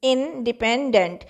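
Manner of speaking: Independent split slowly into three syllables. Shown as a demonstration. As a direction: break independent into in, de-pen, dent. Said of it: "देखिए syllable का मतलब है".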